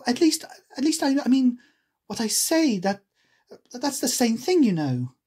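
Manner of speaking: put-on high, stumbling girl voice; flustered heroine; hasty Alice. A narrator's reading.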